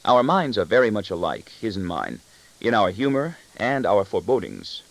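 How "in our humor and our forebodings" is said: plain reading voice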